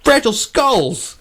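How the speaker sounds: mock outrage. Thinking silently about the grandma, rising mock outrage. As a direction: let disbelief pitch you up to a squeak. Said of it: "And I'm thinking to myself".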